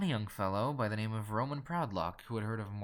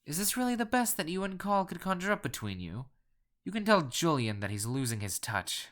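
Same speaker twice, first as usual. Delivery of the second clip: indignant; 7 semitones higher, 4 dB louder than usual. A narrator's reading. Collector accusing the caller of a trick, indignantly. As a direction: scoff in affronted protest. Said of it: "I cried indignantly".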